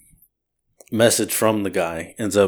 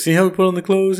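higher, lightly voiced homeless guy; hint of a homeless man teaching; 10 semitones higher than usual. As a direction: coax him like a child. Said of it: "he goes".

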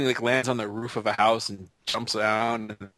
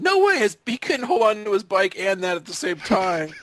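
put-on incredulous kid; 10 semitones higher than usual. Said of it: "I remember as a kid going".

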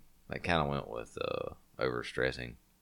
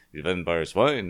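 mock Dracula voice; 3 semitones higher, 8 dB louder than usual.

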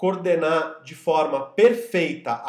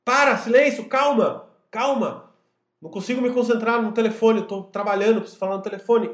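put-on loud, high shout to noisy kids; exasperated shout; 6 semitones higher, 5 dB louder than usual. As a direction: yell for silence, frazzled. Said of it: "Uma hora eu falei".

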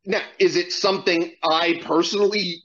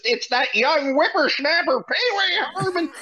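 put-on high, strained old crank voice; grumpy old-timer; 9 semitones higher than usual. Podcast host mocking the townsfolk, in a high old crank voice.

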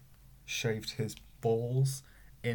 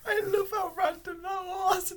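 very high, squeaky laughing speech; helpless laughter. Real, not acted. Friend cracking up at her own random news, helpless with laughter. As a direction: squeeze the words out through real laughter.

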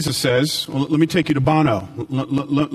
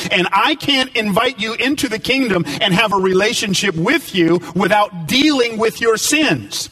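preaching; 5 semitones higher than usual. Acted. Preaching at full force.

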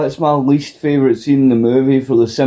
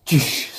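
spray noise; 3 semitones higher, 6 dB quieter than usual. Retold or imitated. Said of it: "he just goes".

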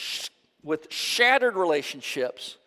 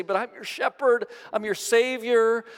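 Jesus voiced in full preaching; 6 semitones higher than usual. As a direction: proclaim his promise with fervor. Jesus speaks in the prayer scene.

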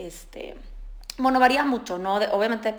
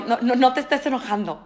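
mock scolding parent; 3 semitones higher, 7 dB louder than usual.

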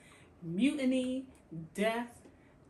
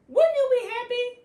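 high, loud, incredulous; can't believe she is upset; much higher and louder question to the audience; exasperated; 13 semitones higher, 10 dB louder than usual. Real, not acted.

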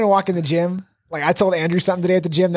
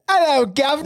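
high-pitched Cockney-style British greeting; hammed-up British accent; mock British accent; 9 semitones higher than usual. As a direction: chirp it in a silly British accent. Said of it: "adopt a British accent every time".

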